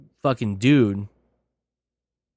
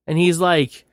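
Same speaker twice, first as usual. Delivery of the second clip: usual speaking voice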